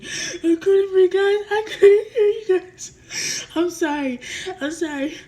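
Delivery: imitated high, loud sobbing plea; crying. Narrator on the floor to worried sister, tearful wailing. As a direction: wail it out through mock tears, easing into apology. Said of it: "I was already crying".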